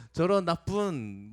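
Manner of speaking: slow, drawn-out mock cursing; slow imitation of muttered anger; mock angry curse. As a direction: spit it out slowly like a half-swallowed insult. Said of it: "소리치죠".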